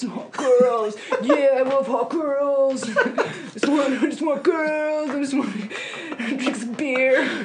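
put-on a girl clowning a beer-loving dude; mock guy voice. Woman mocking how guys hit on girls, hammed-up bro voice. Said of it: "It's my guy voice".

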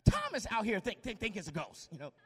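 ordinary talk

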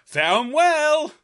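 grand farewell. Pretend. Joke sign-off.

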